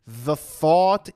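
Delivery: very slow, drawn-out narration; showing how slowly he thinks the thought. Shown as a demonstration. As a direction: ease every word out slowly. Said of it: "in a very slow way".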